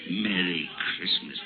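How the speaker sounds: low, soft scornful echo of the greeting; mock the greeting with contempt; a low sneering echo; sneering mimicry. Scrooge mocks nephew's greeting.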